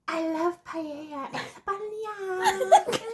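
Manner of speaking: slow, high, stretched-out fake Spanish accent; mock Spanish accent. Sister parodies the actor's Spanish, hammed-up Spanish accent. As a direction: ham up a drawn-out Spanish accent. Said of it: "They're supposed to be Spanish".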